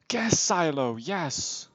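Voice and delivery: gasping, slow, thrilled; excited gasp. Real, not acted. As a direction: gasp with delight. Gasp then delight.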